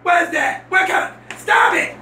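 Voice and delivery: high-pitched, shouted alarm; alarmed scolding. Acted. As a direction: shout in alarm to make it stop. Alarmed at catching someone in the act.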